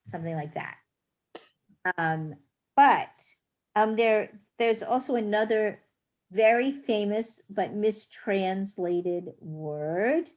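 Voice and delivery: ordinary talk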